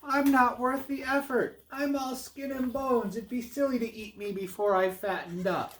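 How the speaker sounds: very high, small goat voice; little goat pleading. Acted. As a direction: talk the troll out of it as the littlest goat.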